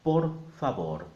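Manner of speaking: "favor" with the v said as b, both words firm; Spanish V as B. Shown as a demonstration. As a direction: punch "por favor", saying "favor" with a b. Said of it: "And the V is a B".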